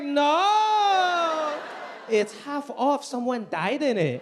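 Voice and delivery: put-on loud, high accented mom; proud of the deal; mom's bargain brag. Son imitating mom bragging about a half-price house, loud and high. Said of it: "She was like".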